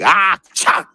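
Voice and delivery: sharp high mock sneeze; imitated sneeze. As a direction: fake a harsh sneeze. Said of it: "chicks that be sneezing like".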